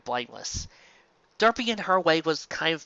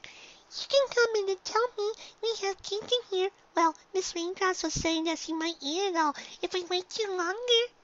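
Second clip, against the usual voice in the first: eager little filly, pitched much higher; 4 dB quieter than usual. Acted. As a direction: chatter like an excited young filly. Voiced filly across many lines.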